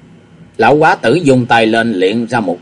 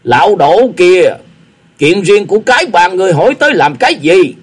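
angry retort; 7 semitones higher, 5 dB louder than usual. A narrator's reading. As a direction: fling the question back in anger. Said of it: "tức giận tằng hắn một tiếng rồi nói".

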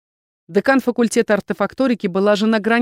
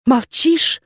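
higher, louder challenge; shouted after him; 6 semitones higher, 5 dB louder than usual. A narrator's reading. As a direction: call it out at his back. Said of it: "в спину ему закричала Виверна".

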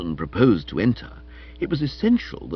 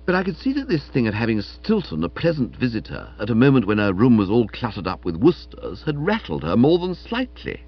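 usual speaking voice

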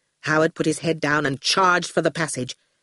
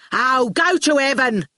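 higher-pitched, exasperated mock shout; angry shout; 6 semitones higher than usual. Shine fires at the screen, shouting angrily. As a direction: yell it back in defiance. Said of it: "Shine shouted and fired".

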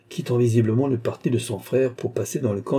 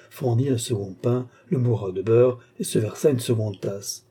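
ordinary delivery, nothing marked

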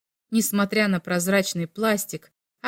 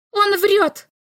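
high, loud accusing shout; 11 semitones higher, 4 dB louder than usual. A narrator's reading. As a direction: yell it out from the crowd. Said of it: "крикнула Ульма".

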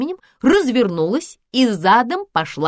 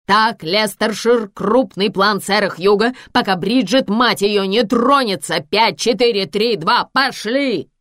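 loud, urgent countdown to air; sustained raised voice, exasperated and commanding; shouted director's cues; 4 dB louder than usual. Acted. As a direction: bark the orders over the earpiece. Director bellows live cues, countdown.

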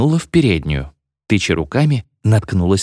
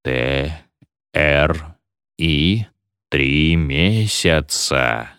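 low, slow, letter by letter; solemn low counting out; seance spelling; 5 semitones lower, much slower than usual. A narrator's reading. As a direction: intone each letter, then the answer, slowly. Sitters count out spirit's answer.

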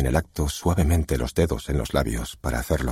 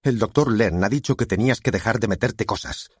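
restrained thunder, pitched up; stern father's angry retort; 7 semitones higher than usual. A narrator's reading. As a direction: scold with a slightly raised voice. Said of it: "con voz de trueno".

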